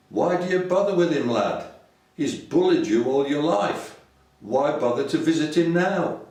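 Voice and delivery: a hint of Zach's scolding; exasperated father. Father scolds son in soap quote.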